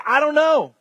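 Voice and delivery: high-pitched admission of not knowing; acted cheerful helplessness; candid shrug. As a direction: throw it out high, conceding freely. Models answer to a challenger.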